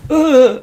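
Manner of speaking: sudden high loud yelp of fright; startled yelp. Retold or imitated. Jumping up as the spider runs up his leg, loud high yelp. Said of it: "like that".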